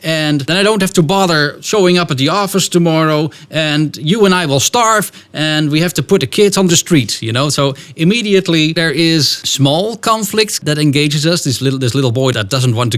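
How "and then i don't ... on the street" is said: a dramatic husband catastrophizing to his wife; worried husband warning. Retold or imitated. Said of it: "he begins to warn his wife".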